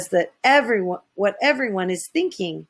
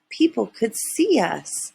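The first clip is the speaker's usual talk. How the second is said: plain reading voice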